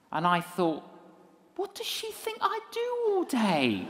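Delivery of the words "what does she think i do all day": high-pitched comic outburst of indignation; içerlemiş isyan. Acted. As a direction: protest it high, like an affronted inner voice. Indignant thought, comic aside.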